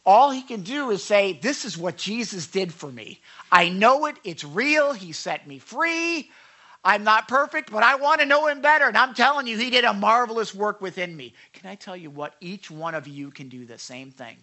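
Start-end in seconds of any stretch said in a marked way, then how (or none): 1.3-11.3 building louder and higher, acted conviction; fervent testimony. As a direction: testify as the rescued man, swelling with conviction. Acts out the saved man's testimony.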